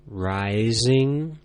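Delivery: "rising," with the pitch sliding upward. Shown as a demonstration. Teacher showing learners the second tone, voice climbing. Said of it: "Now you try it".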